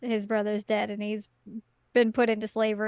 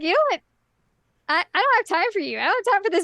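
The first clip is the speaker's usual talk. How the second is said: higher-pitched, hurried brush-off; brash impatient hero; 7 semitones higher, much faster than usual. Acted. Host voicing Jack cutting the villain short, impatient. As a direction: play an action hero with no patience. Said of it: "He's just like".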